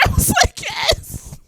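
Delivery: high, breathy squeal of delight; excited squeal. Real, not acted. Watching the couple on screen, a high delighted squeal. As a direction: squeal it like the scene just melted you.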